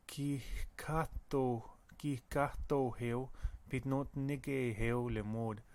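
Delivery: Gihkato heo said slowly, syllable by syllable; slow demo. Shown as a demonstration. Teacher modelling a Michif word for students, slowly. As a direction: spell it out in sound for the class. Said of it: "teacher repeats slowly".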